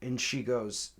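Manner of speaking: ordinary talk, nothing marked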